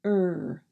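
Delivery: "er" with lips rounded forward, no roll; r-colored vowel, tongue pulled back; English er sound. Shown as a demonstration. Teacher demonstrating the American R for learners. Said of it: "Your lips go forward as you say".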